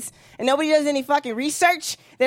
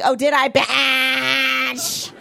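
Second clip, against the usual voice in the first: very slow, drawn-out sassy challenge; sassy taunt, dragged out slow; mock defiant; much slower than usual. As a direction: stretch it out daring her. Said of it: "And I was like".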